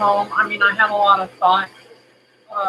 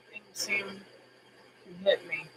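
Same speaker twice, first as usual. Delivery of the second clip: choked whisper; 15 dB quieter, much slower than usual. Real, not acted. Emotional talk about getting older.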